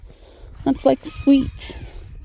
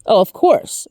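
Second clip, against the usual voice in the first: ordinary talk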